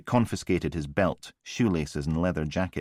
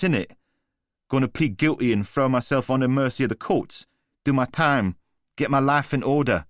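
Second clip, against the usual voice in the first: higher, taunting chav with a sneer; cocky street thug; 7 semitones higher, 4 dB louder than usual. A narrator's reading. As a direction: needle him like a smug geezer. Voice of Newman the thug.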